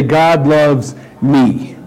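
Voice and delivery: slow build, then "me" set apart on its own; stress on ME. Shown as a demonstration. Preacher showing the congregation how to emphasize different words, stress on "me". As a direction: hold back, then land on "me". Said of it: "emphasize the different words".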